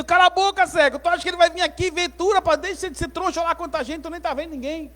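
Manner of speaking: high, fast-running telling-off; scolding crowd. Acted. Crowd hushing the blind Bartimeu, scolding.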